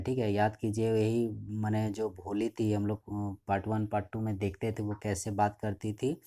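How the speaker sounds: ordinary talk, nothing marked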